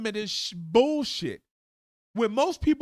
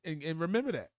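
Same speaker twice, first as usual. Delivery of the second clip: usual speaking voice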